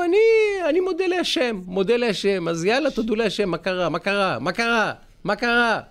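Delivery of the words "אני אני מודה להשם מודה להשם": mock grateful kid. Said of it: "ואמרו".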